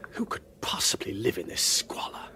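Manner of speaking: hushed whisper at a creepy place. Acted. [name] whispering at the deserted convent, uneasy. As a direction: whisper so nobody inside hears.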